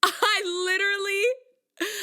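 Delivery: high, drawn-out, hammed-up cry; mock wail. Singer to producers while roommate laughs, mock wailing. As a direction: wail it slowly in mock despair. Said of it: "I'm just sitting there like this".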